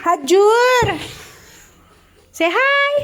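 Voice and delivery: high, loud call. Real, not acted. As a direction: call out brightly to get a greeting. Calls someone to say hi.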